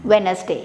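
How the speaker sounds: teacher's model WENZ-day; correct Wednesday. Shown as a demonstration. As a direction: model वेडनेसडे without the d. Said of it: "हमें कहना है".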